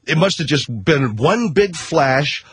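ordinary delivery

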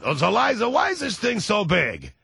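slushy sh for s, like Larry; Larry King voice. Retold or imitated. Larry King asking Liza.

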